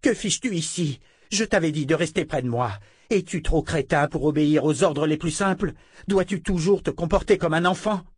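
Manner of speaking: sœur en colère, voix plus aiguë; réprimande furieuse. A narrator's reading. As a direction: gronder un élève désobéissant. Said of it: "blême de colère". (Verna sermonne Richard, voix furieuse et aiguë)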